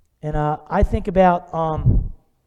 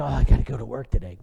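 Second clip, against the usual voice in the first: mock grumble; 7 semitones lower than usual. Mocking reluctant worker.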